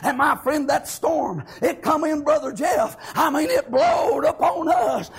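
usual speaking voice

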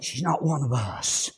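low, soft imitation of a whispered slight; whispered aside. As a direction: lean in and breathe it like a secret. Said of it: "he might have whispered".

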